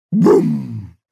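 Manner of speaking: loud, resonant boom; imitated boom. A narrator's reading. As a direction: boom with a long hummed tail. Sound of the pot firing.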